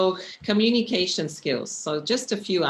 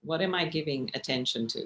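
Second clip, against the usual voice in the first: ordinary delivery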